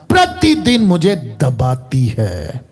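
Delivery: low, very slow, weighed-down quote; पौलुस की परेशानी. Acted. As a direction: drag the words out under a heavy burden. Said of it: "मैं परेशान हो गया". (बोझ तले दबा, धीमा और नीचा)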